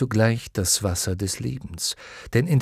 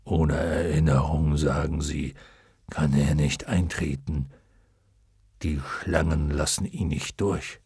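luck dragon; 7 semitones lower than usual. A narrator's reading. Luck dragon explaining the waters to Atreju, low. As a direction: let the dragon speak low and gentle. Fuchur voiced in story.

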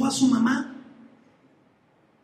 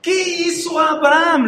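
slow, shouted rhetorical question; preacher's shout; 6 semitones higher, 4 dB louder than usual. Acted. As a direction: shout it slowly at the congregation. Pregunta retórica gritada. (grito lento de predicador)

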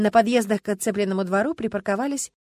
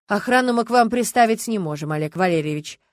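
vindictive; 3 semitones higher, 8 dB louder than usual. A narrator's reading. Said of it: "мстительно сказал Стас".

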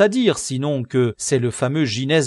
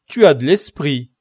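amused remark; 5 semitones higher, 9 dB louder than usual. Acted. Don Quichotte remarks to convict.

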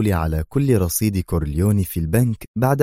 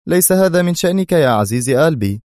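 higher, louder mockery; teasing sarcastic dismissal; 9 semitones higher, 5 dB louder than usual. A narrator's reading. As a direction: brush him off with scorn. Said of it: "أجابه أدهم في سخرية".

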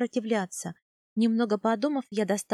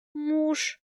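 shy, higher-pitched admission; embarrassed murmur; 5 semitones higher than usual. A narrator's reading. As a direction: say it with bashful hesitation. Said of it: "смущённо пробормотала я". (смущённое бормотание)

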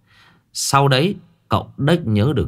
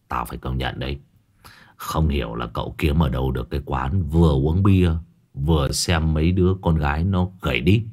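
low, leering gossip; Hải nghẹo leering; 6 semitones lower than usual. Acted. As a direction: savour the memory with a dirty chuckle. Hải nghẹo nods along. (Hải nghẹo giọng trầm, cợt nhả)

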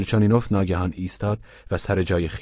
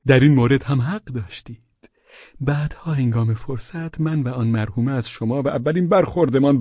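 higher, eager, fawning husband voice; Pavel Pavlovich voiced; 8 semitones higher, 3 dB louder than usual. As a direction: play the meek widower, pitched up. (صدای پاول پاولویچ، زیر و چاپلوس)

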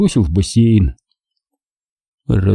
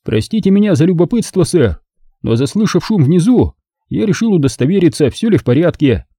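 lightly higher, formal butler voice; polite butler; 7 semitones higher than usual. A narrator's reading. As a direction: speak as the discreet, proper butler. Butler's held voice.

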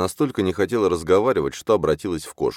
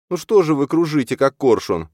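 high, louder, fussing character voice; raised high-voiced reproach; chiding heroine; 10 semitones higher, 5 dB louder than usual. A narrator's reading. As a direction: scold him playfully, pitched up. Heroine chides the circling man.

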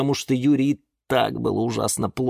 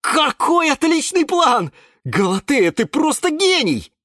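loud, high elation; delighted praise; 5 dB louder than usual. A narrator's reading. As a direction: gush over the brilliant plan. Юра в восторге от плана. (восторженно, громко и высоко)

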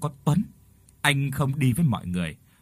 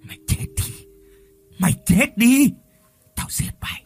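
wild, uneven screams of a crazed man, some rasped out; madman screaming threats; 5 semitones higher, 3 dB louder than usual. A narrator's reading. Crazed man screams at Lựu through the wall. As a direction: rage at the woman behind the wall. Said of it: "hét lên".

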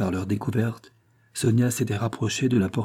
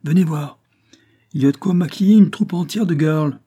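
high, loud announcement of a find; excited calling; 7 semitones higher, 7 dB louder than usual. A narrator's reading. Lantoine shows the police his find, calling excitedly. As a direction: call the others over, excitedly. Officer calls others to a find.

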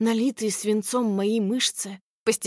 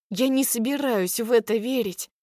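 usual speaking voice

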